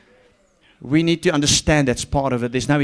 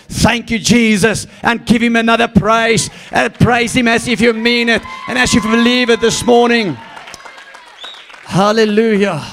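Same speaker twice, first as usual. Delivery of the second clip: fiery preaching; 7 semitones higher, 5 dB louder than usual. Acted. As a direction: proclaim it to the church, rising. Calling church to praise.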